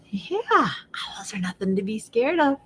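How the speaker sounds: soft reassuring whisper; whispering to calm the children. Acted.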